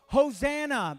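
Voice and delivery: high ringing shout of Hosanna. Shown as a demonstration. Pastor leads the church in a shout of praise. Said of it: "Can you shout that?".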